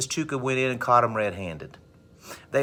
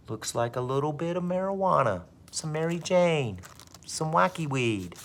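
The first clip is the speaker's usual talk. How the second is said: high, softer teasing lilt; gloating discovery; 5 semitones higher, 4 dB quieter than usual. Acted. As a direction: savor each name for the find. Principal gloating over marijuana find.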